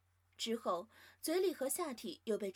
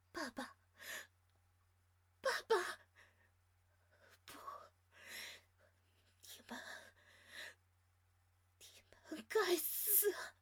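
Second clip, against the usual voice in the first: weak whisper; 8 semitones higher than usual. Acted. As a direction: barely breathe the words out. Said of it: "只能虚弱地吐出这几个字".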